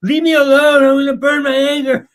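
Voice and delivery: loud, high, hammed-up tantrum; whine-yell like an eight-year-old losing it; mock furious God throwing a fit; child's temper tantrum. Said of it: "an eight-year-old who's losing his temper".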